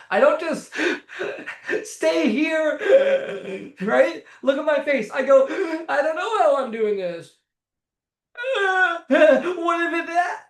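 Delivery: goofy laughing demo. Shown as a demonstration. Showing viewers how to make a client laugh, goofy and giggling. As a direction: ham it up to invite a laugh. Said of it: "watch me for a second, very closely".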